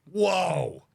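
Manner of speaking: loud, high drawn-out whoa; mock-impressed whoa. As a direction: re-enact reacting to a stinging insult. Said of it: "I go, I go".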